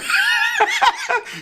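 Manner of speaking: high squealing laugh; real laughter, high and breathless; burst of laughter. Real, not acted. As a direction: crack up at the story. Reacting to story, bursts laughing.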